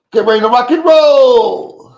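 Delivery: big, loud, slow hype call; roaring rock and roll opener; hype announcer. Acted. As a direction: shout it out like a ring announcer. Hyping up the stream start.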